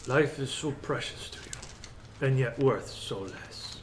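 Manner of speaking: slow, softened villain musing on mortals; leisurely, condescending overlord; Strahd. Acted. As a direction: let each phrase hang with quiet disdain. Game master plays Strahd.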